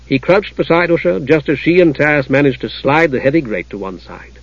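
ordinary delivery

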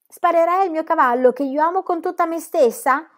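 high, indignant exclamation; girl's voice, outraged surprise; exclaiming. A narrator's reading. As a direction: protest in disbelief. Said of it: "esclamò Pippi".

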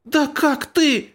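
very high, slow indignant cry; outraged squeal. Acted. Outraged retort in quarrel.

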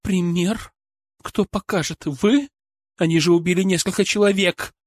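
высокий, возмущённый голос женщины; с вызовом переспросить и обвинить; злое возмущение. Acted. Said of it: "красные и злые". (зло, с вызовом)

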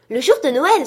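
high, loud burst of joy; thrilled exclamation. A narrator's reading. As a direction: exclaim with delighted relief. Scrooge thrilled to learn the day. (exclamation de joie, forte et aiguë)

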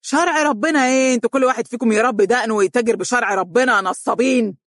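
furious shout. A narrator's reading. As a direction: explode in contempt. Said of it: "وهو يصيح".

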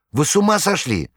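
high, alarmed cry; shocked outcry. A narrator's reading. Saleswoman to the thieves, high shocked cry. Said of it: "вскричала она".

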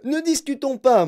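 high, loud cut-off shout; cutting cry. A narrator's reading. Wonka shouting down Mike's objection. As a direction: cut the boy off sharply. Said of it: "cria Monsieur Wonka".

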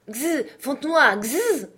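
high buzzing gzz to urge a dog on; sicking the dog. Acted. Sergeant setting his poodle on the bull, high urging buzz. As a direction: hiss the dog onto the bull. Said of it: "fit le sergent".

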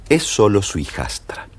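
ordinary delivery

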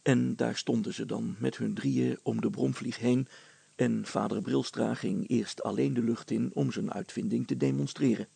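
usual speaking voice

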